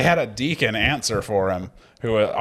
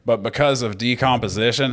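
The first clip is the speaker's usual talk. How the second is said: ordinary talk, nothing marked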